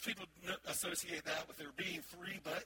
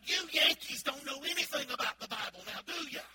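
loud, higher, scolding jab at the Yankee; angry retort; 8 semitones higher, 10 dB louder than usual. Retold or imitated. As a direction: snap at him as the offended clerk. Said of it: "got angry".

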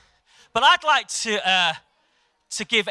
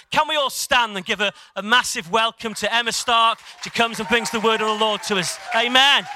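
loud, raised-pitch introduction building to a shouted amen; rally the room, finishing on a shout; rousing welcome; 4 semitones higher, 5 dB louder than usual. Real, not acted. Host calls the church to stand and welcome the guest minister, rousing.

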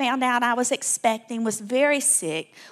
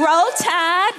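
rising, louder football chant; excited cheer; 6 semitones higher, 6 dB louder than usual. Acted. Alabama native rallying the church crowd, excited cheer. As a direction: shout it like a proud Alabama fan in the stands.

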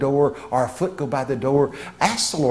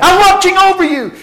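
much higher, much louder shout back; screamed reply; 9 dB louder than usual. Retold or imitated. Angel shouting back at the startled preacher, loud and high. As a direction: yell it right back at him. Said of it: "he screamed back at me".

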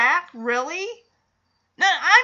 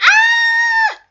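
very high, loud shriek of joy; screaming fan; 6 semitones higher, 6 dB louder than usual. Retold or imitated. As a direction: scream and laugh like a thrilled fan. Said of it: "the audience goes crazy".

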